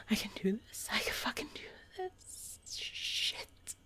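soft breathless whisper; strained whisper. Real, not acted. Struggling to continue, strained whisper. Pep talk while strained.

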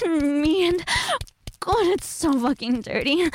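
ordinary talk

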